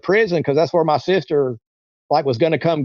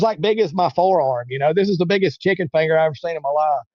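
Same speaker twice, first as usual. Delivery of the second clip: ordinary talk, nothing marked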